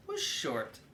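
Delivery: ordinary delivery, nothing marked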